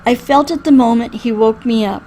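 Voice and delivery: plain reading voice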